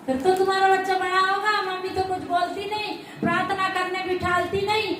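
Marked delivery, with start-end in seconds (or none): none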